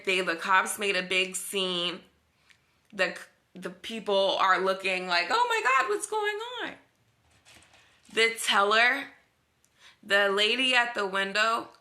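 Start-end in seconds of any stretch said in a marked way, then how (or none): 5.3-6.7 very high-pitched mock gasp of bystanders; shocked onlookers. Onlookers watching the cops make a scene, mock shock. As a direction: imitate gawkers in a tiny high voice. Voicing gawking onlookers in story.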